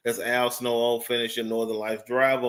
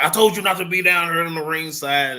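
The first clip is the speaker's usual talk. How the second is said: raised, louder retold scolding; mock yell; 6 semitones higher, 5 dB louder than usual. Robert Stone yelling at Santino to leave ringside, mock loud. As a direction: bark it like a heel sheriff kicking them out. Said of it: "he come down and was like".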